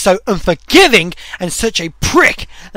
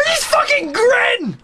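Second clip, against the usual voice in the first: high, strained, drawn-out complaint; real gamer fury; enraged; 12 semitones higher, slower than usual. Real, not acted. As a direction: screech it in frustration. Raging at boss's grin.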